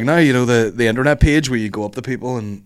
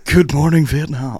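radio DJ greeting; 4 semitones higher, 3 dB louder, slower than usual. Pretend. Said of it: "radio DJ call".

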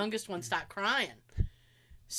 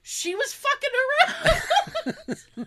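loud, high, slow through laughter; helpless laughter breaking into the quote; laughing retelling; 7 dB louder, much slower than usual. Retold or imitated. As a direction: crack up while quoting dad. Dad quote, teller cracking up laughing.

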